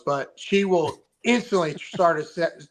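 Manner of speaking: ordinary talk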